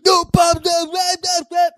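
high, loud mock shout. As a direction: yell it like a punk chant. Mock shouting band's rules.

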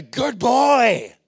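high, loud shout of a proud father; dad shouting from the sideline in delight; proud dad's shout. Retold or imitated. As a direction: yell it across the cricket field. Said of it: "I heard my dad's voice go".

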